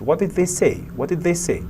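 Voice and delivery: high start, "say" stressed and falling; emphasize "say" and drop the pitch on it; falling intonation. Shown as a demonstration. Teacher demonstrating a what question, "say" emphasized with a fall. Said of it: "the intonation falls at the end".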